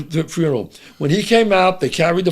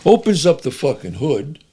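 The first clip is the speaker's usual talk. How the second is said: ordinary talk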